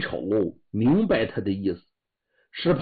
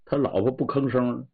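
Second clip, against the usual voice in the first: plain reading voice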